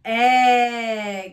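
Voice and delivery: "A" as a long held "eh"; middle sound alone. Shown as a demonstration. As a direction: stretch "A" like the vowel in sell. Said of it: "What's the middle?".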